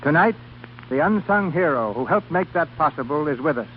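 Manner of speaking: ordinary talk, nothing marked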